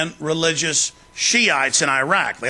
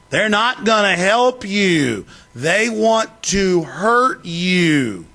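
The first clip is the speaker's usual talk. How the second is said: very slow, heavy warning; slow, deliberate warning; slow emphatic; 3 semitones higher, much slower than usual. Pretend. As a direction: drag each word out to the listener. Continues slow mocking delivery.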